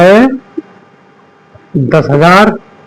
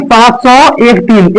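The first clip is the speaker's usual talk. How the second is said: wrong way, digit by digit; 3 semitones higher, much slower than usual. Shown as a demonstration. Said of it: "इसे ऐसे नहीं बोलेंगे".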